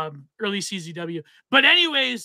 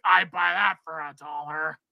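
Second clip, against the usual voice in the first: lower, altered movie clip voice; voice clip quote; 4 semitones lower than usual. Retold or imitated. To the stream, imitating the donation sound clip. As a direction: mimic the stock soundboard line. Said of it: "It was like".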